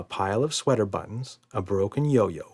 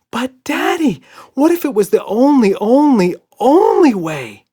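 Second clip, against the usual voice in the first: high loud whining plea; pleading whine; 11 dB louder than usual. A narrator's reading. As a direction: beg tearfully, stretching only. Emma pleading hysterically.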